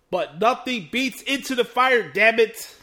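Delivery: loud and high, fired up; excited. Real, not acted. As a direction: shout it like a proud fan. Praising favourite theme song.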